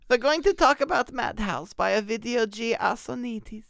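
high, hammed-up German accent; German inflection. Host introducing Madhouse to listeners, mock German inflection. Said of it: "the German inflection".

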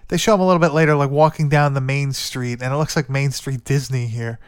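usual speaking voice

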